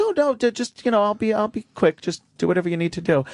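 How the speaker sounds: high, hurried imitation of the maid; flustered maid, pitched well up; maid's voice. Voices the maid in his story.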